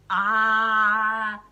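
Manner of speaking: very loud open-mouthed ah; loud wide-mouthed ah; big ah. Acted. Froggy opens wide.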